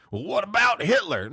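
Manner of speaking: high, loud sneering heckler; mock heckler voice. Podcaster mocking a commenter who asks about Hitler, high snide voice. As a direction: toss it back like a smug commenter.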